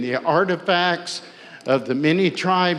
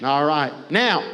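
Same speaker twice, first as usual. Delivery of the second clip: usual speaking voice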